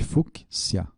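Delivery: "fúcsia" split with a gap after fuc; divided into syllables. Shown as a demonstration. Teacher reminding how the word divides, "fúcsia" in separate syllables. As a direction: say "fúcsia" in pieces, fuc, sia. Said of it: "lembra que ela divide".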